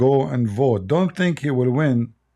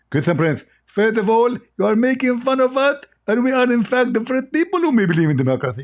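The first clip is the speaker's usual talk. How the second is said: Zakir impression; 10 semitones higher than usual. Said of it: "Is that correct, Zakir?".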